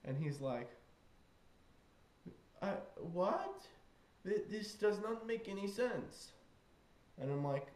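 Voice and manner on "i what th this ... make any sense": lightly imitated British boy, higher pitch; puzzled British child, badly imitated; bad British accent. British boy baffled by stranger's claim, badly imitated British accent. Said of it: "I can't do his accent at all".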